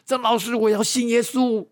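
high, drawn-out imitated cry; retold crying-out. Piano teacher calls to the preacher to believe, high imitated cry. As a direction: call out high like a man rushing forward in tears. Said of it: "就大声地喊着说".

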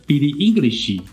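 Billie English, slowly, [name] misread as English; drawn-out English in place of [name]; DJ's mispronunciation. Retold or imitated. Host quoting DJs introducing [name], mispronounced. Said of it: "有些D J就念".

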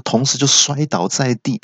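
ordinary delivery

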